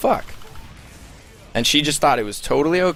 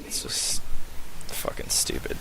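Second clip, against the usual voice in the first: whisper; 7 semitones lower, 5 dB quieter than usual. Retold or imitated. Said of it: "And she's like".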